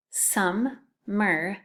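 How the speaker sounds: hap-py split into two beats, each syllable tapped. Shown as a demonstration. Teacher to second graders, splitting hap-py into syllables. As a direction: tap out hap-py slowly, one syllable at a time. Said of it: "The next one is hap-py".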